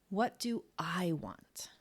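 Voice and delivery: a slow whisper with "What" carrying the weight; hushed and slow, "What" stressed. Shown as a demonstration. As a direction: whisper it slowly, pressing on "What". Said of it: "with the emphasis on different words".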